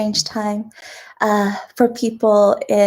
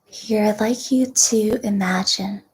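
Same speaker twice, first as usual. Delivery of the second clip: guided meditation; 3 semitones lower, 5 dB quieter than usual. Real, not acted. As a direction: guide them gently into deep breathing, unhurried.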